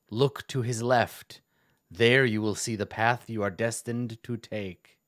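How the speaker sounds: slow, mysterious theatrical pronouncement; Halaster, theatrical. Acted. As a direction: intone a prophecy slowly, trying to sound mysterious. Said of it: "putting on this weird theatrical voice".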